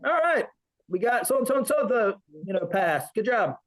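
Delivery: a touch of a high barking senior drill instructor. Retold or imitated. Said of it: "the senior actually came out and said".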